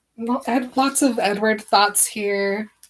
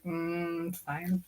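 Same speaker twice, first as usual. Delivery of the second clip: grudging mumble; 3 semitones lower, 13 dB quieter than usual. Retold or imitated. Bella reluctantly agreeing.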